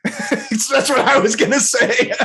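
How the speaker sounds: high, loud words through laughter; drawn-out, laughing admission. Real, not acted. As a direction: crack up while getting the words out. Cracking up at co-host's remark.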